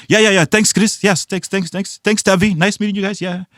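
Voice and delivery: high-pitched string of eager thanks; hurried polite thanks. Acted. Client thanking both business partners, in a high, over-polite voice. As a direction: act out effusive goodbyes in a high voice.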